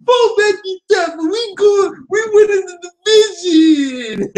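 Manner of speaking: very high squealing whiny mock voice, half crying; mocking clown voice. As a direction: whine it out like a sobbing clown. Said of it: "You sound like Judah Tribe".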